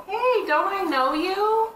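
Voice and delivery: high, slow, sing-song greeting; playful stranger voice. Acted. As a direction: call out like a friendly stranger. Player voicing stranger greeting.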